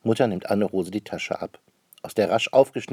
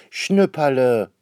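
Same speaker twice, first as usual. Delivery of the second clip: slow, drawn-out call, higher and louder; 5 semitones higher, 7 dB louder, slower than usual. A narrator's reading. Sister calls her messy brother, drawn-out scolding call. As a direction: stretch the name in a scolding call. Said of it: "ruft Anne-Rose".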